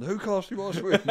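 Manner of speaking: repeating the shout through laughter; laughing repeat. Retold or imitated. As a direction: say it again while cracking up. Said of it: "He went".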